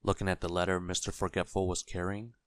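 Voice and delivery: ordinary delivery, nothing marked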